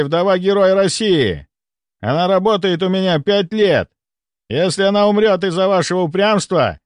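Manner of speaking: high, forceful dressing-down by a count; barked angry threat. A narrator's reading. The count threatens the stubborn doctor, barking orders. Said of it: "рявкнул граф".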